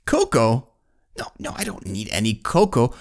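baffled builder. Acted. Builder mishears again.

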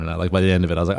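ordinary talk

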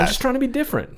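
put-on high squeaky boyish voice. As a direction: squeak it out like a young boy. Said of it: "You sound like a squeaky boy".

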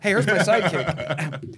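slow, laughing hammed-up buddy introduction; teasing, laughing sidekick voice; jokey mock intro. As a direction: show off your pal, slow and amused.

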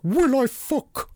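high, slow mock Scottish snap; mock Scottish retort. Actress's character refusing Mark, mocked in a bad Scottish accent. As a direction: fling it back in a fake Scottish accent. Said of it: "And she's like".

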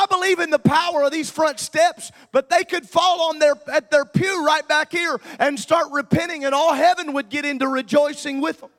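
fervent revival preaching rising over the music; full-force preaching. Acted. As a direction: preach it out to the back pew.

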